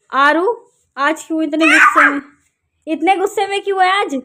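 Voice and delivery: demanding to know why she's angry; scolding call. Real, not acted. Mother scolding angry daughter. (गुस्से में ऊँची आवाज़ में डाँटना)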